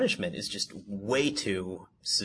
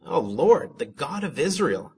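higher, mildly pleading read cry; 7 semitones higher, 5 dB louder than usual. A narrator's reading. People of Israel crying to God, mildly pleading. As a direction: read it as a raised plea to God. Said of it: "they cried".

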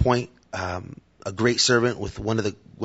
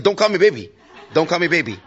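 higher, louder mock protest; mock indignant; 7 semitones higher, 4 dB louder than usual. Telling his sister off, mock indignant protest. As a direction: push back like a wounded man. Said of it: "I'm like".

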